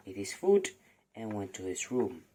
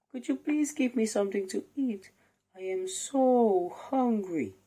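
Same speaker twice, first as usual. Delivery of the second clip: put-on much higher, small pleading boy voice; hungry boy Jack; 12 semitones higher, 5 dB louder than usual. Jack begs the giant's wife for food, high pleading boy voice. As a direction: plead like a starving little boy.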